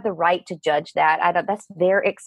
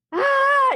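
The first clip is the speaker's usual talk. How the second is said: sudden high yelp of panic, replayed; retold scream of alarm, high and sharp; panicked cry; 4 dB louder than usual. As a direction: cry out like waking up terrified. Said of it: "I was freaking out and I'm like".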